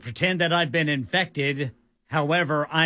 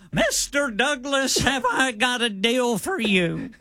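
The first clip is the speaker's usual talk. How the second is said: higher, huckster's sales pitch voice; Mister Haney impression; 9 semitones higher than usual. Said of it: "sounded like Mister Haney".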